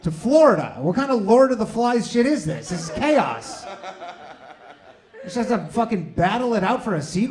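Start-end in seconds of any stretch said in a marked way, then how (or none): 0.0-3.6 sarcastic rant. Retold or imitated. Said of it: "I'm like".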